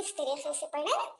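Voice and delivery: ordinary talk, nothing marked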